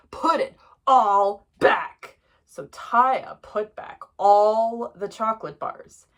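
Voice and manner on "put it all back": slow, loud, word-by-word command; stern telling-off; firm order. A narrator's reading. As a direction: space it out like a fed-up dad. Father orders child.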